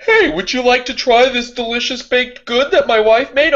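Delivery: high, eager imitation of a host; mock friendly offer. Imitating a man offering his wife's baking, mock friendly. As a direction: imitate someone proudly offering a treat. Acts out someone offering baked goods.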